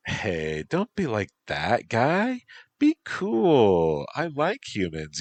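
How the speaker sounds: put-on high, teasing valley girl; bubbly valley girl teasing. Bee girl character speaks.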